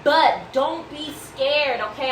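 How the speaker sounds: slow and soothing, coaxing a nervous cook; calm them down gently, drawn out; reassuring. Real, not acted. To a viewer worried by the funky-looking meat, slow gentle reassurance.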